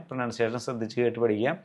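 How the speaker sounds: ordinary talk, nothing marked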